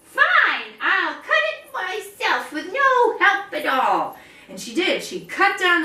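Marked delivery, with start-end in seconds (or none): 0.0-4.2 much higher, louder, slow and firm; determined hen. Acted. Hen refuses to quit, high determined voice. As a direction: declare it with stubborn resolve. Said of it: "She said".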